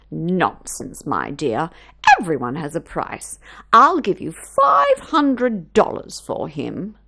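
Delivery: a rich woman sure she can buy anything; haughty rich lady. Acted. Mrs Larchmont makes her offer.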